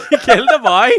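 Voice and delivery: high, loud, laughing echo in mock Scottish. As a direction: shriek it back through laughter, Scottish-style. Echoing the Scottish bit, laughing.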